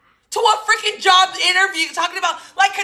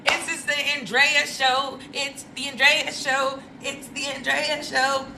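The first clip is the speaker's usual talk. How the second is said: show intro; 3 semitones lower, 7 dB quieter than usual. Acted. Show intro chant, repeated slogan.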